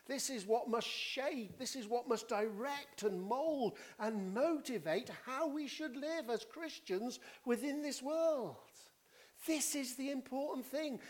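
high-pitched, insistent sermon delivery; urgent, emphatic proclamation; impassioned preaching. Real, not acted. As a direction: press each phrase home to the congregation.

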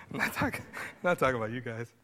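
light, breathy laugh while backtracking. Real, not acted. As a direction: laugh breaking into the words. Joking disclaimer to the congregation.

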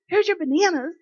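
high, bright mock teen voice; imitating a beaming teenage girl handing over bananas; cheerful teenage girl.

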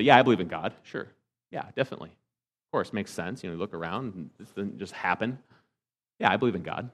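mock casual believer. Mocking polled nominal believers.

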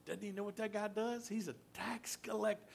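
hushed, scandalised whisper; gossiping whisper. Retold or imitated. Onlookers gossiping as Jesus goes to the tax collector's house, whispered. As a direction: whisper it behind your hand to a neighbour. Crowd gossiping about Zacchaeus.

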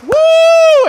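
very high, loud whoop; crowd cheer. Acted. Crowd cheers tightrope walker's crossing, excited whoop. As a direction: let out a long excited woo.

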